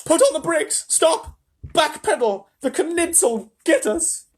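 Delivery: high, loud frantic screech; panicked old grandma. A narrator's reading. Grandma Josephine begs Wonka to turn back, shrieking. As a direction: stammer in terror then order.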